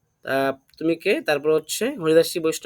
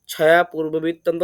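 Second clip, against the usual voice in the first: ordinary delivery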